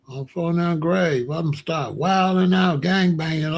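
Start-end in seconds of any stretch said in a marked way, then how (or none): none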